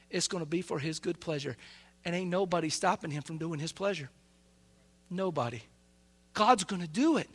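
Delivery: ordinary delivery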